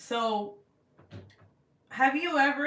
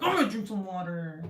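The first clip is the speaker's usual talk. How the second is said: growled-out everyday remark; mock-angry. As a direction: rage playfully over something trivial. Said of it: "if someone just talked like that".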